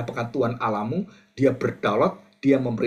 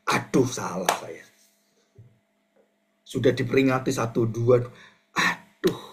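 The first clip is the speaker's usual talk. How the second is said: regretful Paul. Acted. Paul realizes his mistake.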